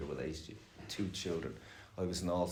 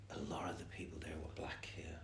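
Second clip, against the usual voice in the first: whispered, softer; whispered aside; 9 dB quieter than usual. Real, not acted. Telling a friend about the meeting, whispered softly. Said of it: "whispered aside".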